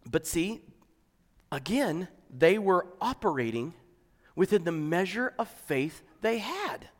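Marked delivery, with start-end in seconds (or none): none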